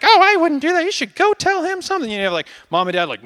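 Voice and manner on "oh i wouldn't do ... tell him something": much higher, louder gossipy friend voice; meddling friend. Retold or imitated. Preacher voicing friends giving bad advice, higher gossipy mock voice. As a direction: egg him on like a nosy friend. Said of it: "You have friends like".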